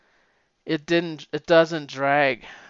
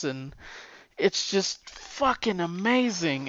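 ordinary talk, nothing marked

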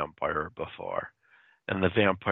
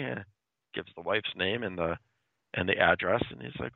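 normal talking voice

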